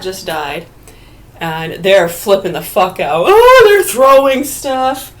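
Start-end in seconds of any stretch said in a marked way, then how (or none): 3.3-5.1 slow, high, loud mock panic; mock alarmed shout. Mock panicked shout about someone throwing things. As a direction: cry out in exaggerated alarm at the mess.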